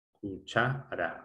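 "Cuchara" slow and soft, split as cu-cha-ra; syllable by syllable. Shown as a demonstration. Teacher testing the stressed syllable, syllable by syllable. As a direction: quietly separate the syllables of "Cuchara". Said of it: "la sílaba tónica".

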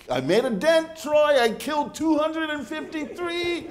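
father-in-law proudly reporting ants he killed. Retold or imitated. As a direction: brag the count like a trophy. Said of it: "He says".

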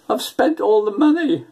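high tearful wail; mock sobbing bride. New bride confessing to husband, imitating her tears. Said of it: "through the sobs".

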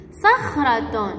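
slow, drawn-out model of "صخرة"; sounding out. Shown as a demonstration. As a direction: hold the sounds of "صخرة" for the children. Teacher sounds out example word.

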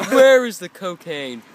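loud, slow, laughing cop grill; laughing mock interrogation. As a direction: demand it while cracking up.

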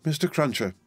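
high, plaintive call; acted tearful address; through tears. A narrator's reading. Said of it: "said through her tears".

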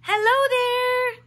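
a new rabbit hailing strangers; rabbit calling hello. A narrator's reading. A stranger rabbit calling hello to the bears. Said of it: "called a rabbit".

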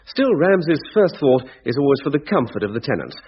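ordinary talk

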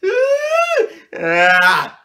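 very high, loud, drawn-out screams; panicked screaming. Retold or imitated. Trapped kid losing it, repeated panicked screams. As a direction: scream your head off. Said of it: "I'm freaking out".

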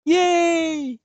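high, loud happy yay; excited cheer. Real, not acted. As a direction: cheer like you just won. Cheering a reward.

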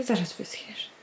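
soft whispered comfort. Real, not acted. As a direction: reassure the dog in a whisper. Whispering to dog. (szept uspokajający psa)

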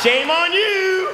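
slow, high, laughing scold. Retold or imitated. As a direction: draw out the scolding, half laughing. Scolding guy with potato.

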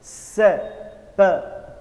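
"से" and "प" each said alone, with a pause between; teacher demonstrating "से" and "प" one by one; separate syllables. Shown as a demonstration. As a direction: isolate "से", then "प", as single sounds. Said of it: "तो वो अलग-अलग मी-, शब्द बना रही है".

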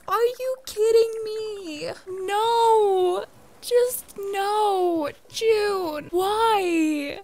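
hammed-up sobbing, high and loud; fake-sob over the missing villager; mock crying. Gamer wailing to viewers that June is gone, with mock sobs. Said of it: "I'm crying".

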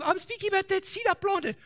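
a put-upon planter explaining; lightly voiced sulky farmer; gloomy planter. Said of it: "And they saying".

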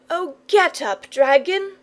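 put-on high, pleading little-boy voice; piteous cry. A narrator's reading. Tired boy to sleeping dragon, piteous cry. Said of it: "cried the boy piteously".

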